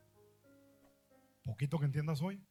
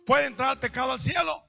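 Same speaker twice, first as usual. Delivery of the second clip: louder, higher challenge to the crowd; rising shout; 8 semitones higher, 8 dB louder than usual. Real, not acted. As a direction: hurl the question at them. Preacher repeats question, building.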